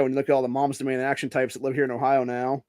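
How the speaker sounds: ordinary talk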